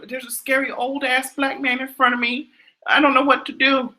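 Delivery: high, put-on helpless caller voice.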